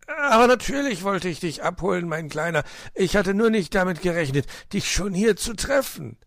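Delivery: lively, emphatic fox uncle; quick-thinking fox uncle. A narrator's reading. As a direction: hastily reassure the little nephew.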